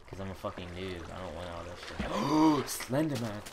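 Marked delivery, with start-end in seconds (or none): 2.0-3.4 sharp frightened gasp, then a cry; scared gasp. Real, not acted. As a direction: gasp and yell his name in fright. Spots Slenderman in game.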